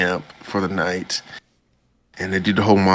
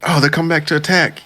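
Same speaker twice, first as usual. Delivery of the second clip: higher, fast mock warning; character voice; 9 semitones higher, 3 dB louder, faster than usual. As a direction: rush the warning in a raised voice. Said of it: "And then he's like".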